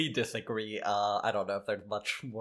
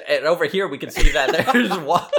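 loud laughing broadcaster bit; laughing mock commentary; 6 semitones higher, 12 dB louder than usual. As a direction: giggle through the play call.

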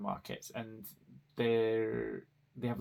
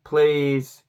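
a louder, desperate "please"; pleading louder; 4 semitones higher, 13 dB louder than usual. Retold or imitated. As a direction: beg him to stop, mortified. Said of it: "I'm like".

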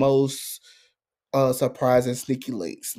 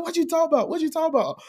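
confused; 13 semitones higher, faster than usual. Retold or imitated. The friend's confused reply.

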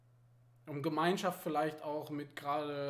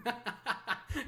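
giggling; 8 semitones higher than usual. Real, not acted. Laughs at own story.

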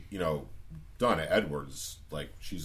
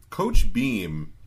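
emphatic loud repeat; 6 semitones higher, 10 dB louder than usual. Acted.

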